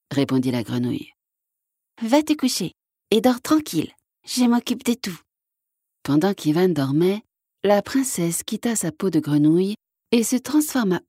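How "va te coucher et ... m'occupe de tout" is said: little frog, reassuring. Acted. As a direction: send him to bed reassuringly.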